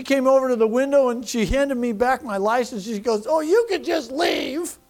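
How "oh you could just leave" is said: high, drawn-out mock crying voice; hammed-up tearful dismissal, stretched out; imitating tearful woman. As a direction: mimic a crying officer giving up. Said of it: "And she cried".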